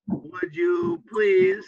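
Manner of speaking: high, drawn-out polite request. Shown as a demonstration. Teacher showing a polite favor, high and slow. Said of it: "very polite".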